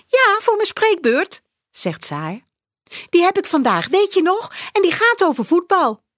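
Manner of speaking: hoog meisjesstemmetje, vrolijk; blij kinderstemmetje. A narrator's reading. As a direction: een enthousiast klein meisje. Saar explains her talk. (Saar vertelt blij over haar bal)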